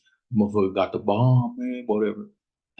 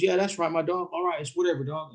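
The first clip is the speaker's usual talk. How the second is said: high-pitched imitation of a rival; put-on high voice, feigned indifference; mock dismissive homeboy; 11 semitones higher than usual. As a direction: pitch it up and brush him off. Imitating rival dismissing him on phone.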